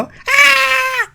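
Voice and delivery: high-pitched loud "ah" scream; demonstrated scream. Shown as a demonstration. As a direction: let out a sustained demo scream. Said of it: "이렇게 소리 지르는 거 비명이죠". (높고 크게 지르는 비명)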